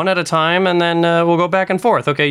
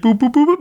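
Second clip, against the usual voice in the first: high beep boop noises; robot beeping; 11 semitones higher, faster than usual. Shown as a demonstration. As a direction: chirp it like a robot.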